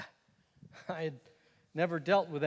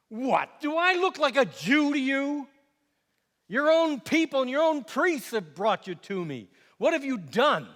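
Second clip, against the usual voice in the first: raised pitch, loud and incredulous; indignant outburst; 8 semitones higher, 4 dB louder than usual. Acted. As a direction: fire the questions back, affronted. Governor indignantly questions his prisoner.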